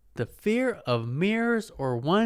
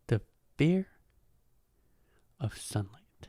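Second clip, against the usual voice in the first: sunlight dropped to a whisper; 5 dB quieter than usual. Shown as a demonstration. Repeating the phobia to viewers, whispering the end. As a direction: hush the words of sunlight into a whisper.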